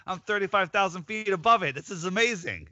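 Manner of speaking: high, excited gushing to fellow passengers; excited tourist. Pretend. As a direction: point down at your house in wonder. Imagined excited passenger on plane.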